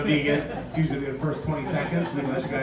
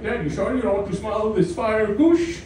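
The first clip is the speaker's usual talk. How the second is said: deli friend; 4 semitones higher than usual. Retold or imitated. Deli friend offering weed.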